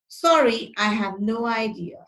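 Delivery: ordinary talk, nothing marked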